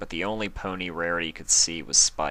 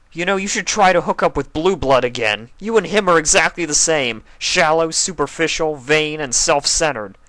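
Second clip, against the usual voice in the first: high, loud bitter shout; furious; 8 semitones higher, 8 dB louder than usual. Acted. Spike in tears, shouting insults at Rarity. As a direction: spit the insults out.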